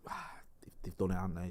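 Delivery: whispered, resigned aside to himself; hushed inner thought. Retold or imitated. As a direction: breathe it out as a quiet realisation. Retold thought during fireworks crowd.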